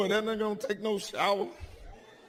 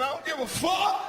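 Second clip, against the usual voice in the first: defiant shout; 7 semitones higher than usual. Real, not acted. Defiant retort to silent crowd.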